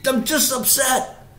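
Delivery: high, drawn-out wail of protest; acted tearful, angry pleading; upset pleading. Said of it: "as I'm crying, I'm pleading, I'm angry".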